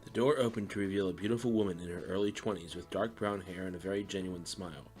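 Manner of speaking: usual speaking voice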